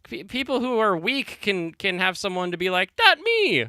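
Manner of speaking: high, loud squeaky cartoon voice; over-the-top high anime boy impression; mocking blonde character. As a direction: whine it like a goofy anime sidekick. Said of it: "This blonde one right here was like".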